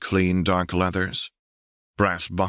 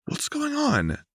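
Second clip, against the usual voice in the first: puzzled question; slower than usual. A narrator's reading. Lux puzzled.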